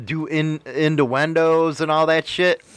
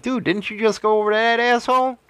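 incredulous retort; 9 semitones higher, faster than usual. Acted. Acted retort to two-faced commenter.

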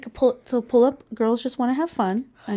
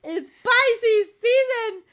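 excited; 12 semitones higher, 6 dB louder than usual. Real, not acted. Celebrating the matching word.

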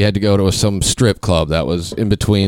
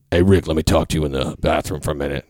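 bodyguard voice; 4 semitones lower, 3 dB quieter, faster than usual. Retold or imitated. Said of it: "the bodyguard's like".

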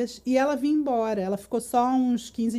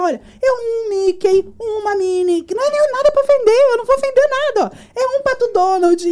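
high, loud, rushed mock tirade; hammed-up hysterical protest, words tumbling out; retold frantic yelling; 9 semitones higher, 8 dB louder than usual. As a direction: act out shouting at the customs officer. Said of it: "Aí eu começava a gritar".

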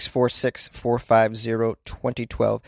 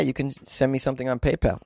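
ordinary delivery

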